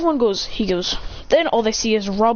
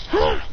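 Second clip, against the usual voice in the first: a sharp high yell with the swing; high battle cry; 11 semitones higher than usual. Retold or imitated. Hero smashes All For One, high battle cry. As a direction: yelp it way up high. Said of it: "he goes".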